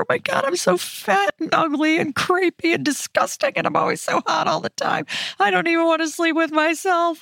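high, whiny self-pitying rant; whiny inner voice. Retold or imitated. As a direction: whine about yourself like a spiraling mind. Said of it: "This is what my thoughts used to sound like".

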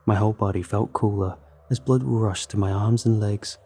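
usual speaking voice

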